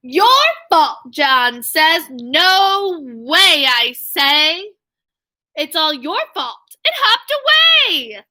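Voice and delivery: lively, sing-song storybook read; bouncy picture-book reading voice; reading to children. A narrator's reading. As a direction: read the rhyme aloud to young kids. Teacher reading picture book aloud.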